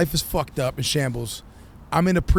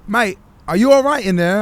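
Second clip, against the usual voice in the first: loud, higher mock London lad calling in; London British accent; 5 semitones higher, 7 dB louder than usual. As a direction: call through a door, imitating a London mate. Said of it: "these two London British kids".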